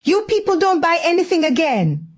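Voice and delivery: high, whining complaint; complaining. Acted. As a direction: grumble it like a fussy aunt. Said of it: "Auntie would still complain".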